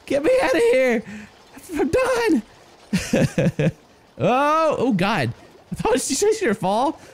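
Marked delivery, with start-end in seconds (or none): 0.0-3.7 high, strained mock complaint; imitating a fed-up colonist. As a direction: whine like an overworked little worker stuck on the job. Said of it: "He's like".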